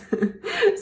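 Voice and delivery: quick bright laugh; short laugh. Real, not acted. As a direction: laugh out briefly. Laughs mid poem.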